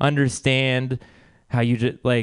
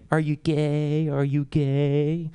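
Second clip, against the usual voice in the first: drawn-out schoolyard taunt, slowing; mock taunt; much slower than usual. Schoolmates teasing the comedian, drawn-out mock taunt. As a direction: imitate kids teasing, stretching the words.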